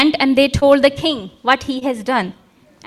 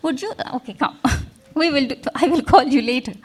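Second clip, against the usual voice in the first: normal talking voice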